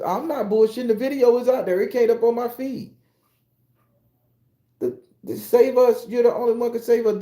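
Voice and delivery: high, urgent mock plea; hammed-up pleading for rescue. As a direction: beg like a desperate caller. Mocking pleas to save homeland.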